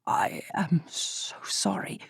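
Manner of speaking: slow, breathy whisper of grief; tearful whisper. A narrator's reading. Lofton to Arabella on hearing of his friend's death, in a tearful whisper. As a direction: hush it out, holding back tears. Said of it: "the tears threatening to brim over".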